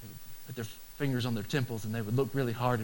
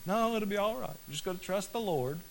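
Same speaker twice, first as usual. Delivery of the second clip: higher, lightly put-on carefree voice; mock breezy reply; 8 semitones higher than usual. Pastor voicing a naive optimist, lightly mock carefree. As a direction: shrug it off like nothing's wrong.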